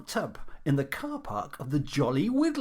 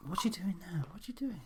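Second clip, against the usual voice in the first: whispering; 7 dB quieter than usual. Acted. Whispered aside on air.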